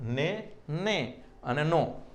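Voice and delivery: ને, ને and નો said slowly, each sound held apart; slow sound demo. Shown as a demonstration. Teacher showing students how the sounds differ, slow and careful. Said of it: "આ ને ના નેમાં ફેર છે".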